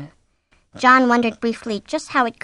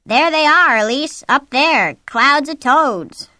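high, loud joking proclamation; mock excitement; 6 semitones higher, 8 dB louder, slower than usual. A narrator's reading. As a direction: call it out slowly, playing up the absurdity. Mock announcement of falling toads.

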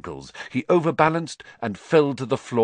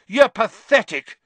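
high, loud outburst; angry yell; 12 semitones higher, 8 dB louder than usual. A narrator's reading. Harry yells at Snape, furious. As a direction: yell it in his face. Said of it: "Harry yelled".

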